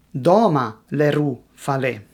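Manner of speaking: "Doma le ru fa le" stressed DOma, leRU, faLE; "Doma le ru fa le" with only the first word front-stressed; mixed syllable stress. Shown as a demonstration. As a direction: punch the first syllable of "Doma", then the second in "le ru" and "fa le". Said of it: "which phrase has the stress on".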